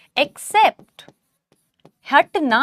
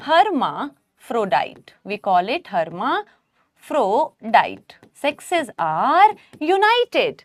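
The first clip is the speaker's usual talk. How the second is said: drawn out, every syllable clear; dictating the new word; slow dictation; 2 semitones lower, much slower than usual. Shown as a demonstration. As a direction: say the term slowly so they can write it. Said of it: "dictating the term slowly".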